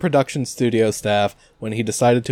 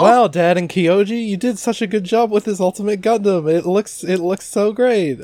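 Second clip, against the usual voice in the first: starry-eyed gushing; 9 semitones higher than usual. Pretend. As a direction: gush like an overjoyed anime hero. Gushing as Domon in happy ending.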